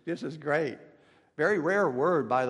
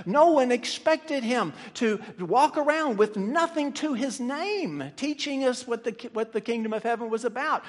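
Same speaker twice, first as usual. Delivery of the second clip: raised, rolling pulpit cadence; impassioned preaching; 6 semitones higher, 3 dB louder than usual. Real, not acted. Preacher recounting what no one expected of Jesus, impassioned. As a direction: pile up each point with rising fervor.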